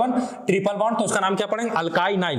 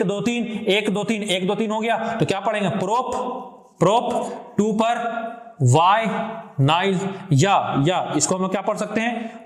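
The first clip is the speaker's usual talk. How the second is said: usual speaking voice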